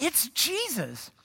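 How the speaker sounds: high, slow, drawn-out revelation; awed realisation. Acted. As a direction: let the name dawn on you, stretched out. John realises who it is.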